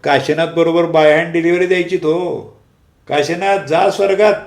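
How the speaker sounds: a second colleague yelling a joke at the meeting; mocking shout. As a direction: call it out loud and high to get a laugh. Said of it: "दुसरा एक जण ओरडला".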